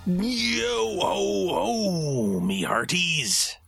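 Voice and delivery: gravelly wrestler pirate growl; pirate Macho Man. Pretend. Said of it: "talking like a pirate Macho Man".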